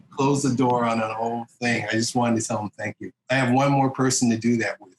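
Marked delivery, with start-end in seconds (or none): none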